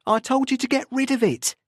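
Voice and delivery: high, barely controlled anger; almost shouting it down the line; angry near-shout. A narrator's reading. Anderson to Tim on the phone, angry near-shout. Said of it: "Anderson almost shouted down the phone".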